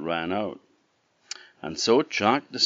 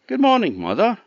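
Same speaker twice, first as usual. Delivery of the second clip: put-on much higher, loud boyish greeting; Jack's greeting; 7 dB louder, faster than usual. A narrator's reading. Jack greets the tall woman at the castle, high and bright. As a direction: sing it out like a cheeky lad.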